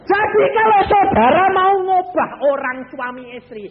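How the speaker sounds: high, loud preaching cry. Real, not acted. Preacher warning the congregation, loud and high. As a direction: hammer the point home. Preacher driving home the point.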